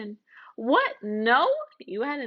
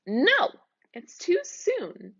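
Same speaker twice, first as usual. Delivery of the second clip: sharp objection; 7 semitones higher, slower than usual. Acted. Narrator objects to witch.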